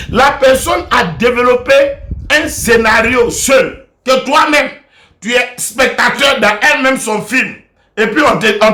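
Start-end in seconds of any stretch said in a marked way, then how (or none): none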